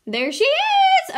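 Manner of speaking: high, drawn-out cry of discovery; excited high squeal; excited exclamation. Real, not acted. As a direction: squeal it out, stretching the last word. Player spots the jammer sought.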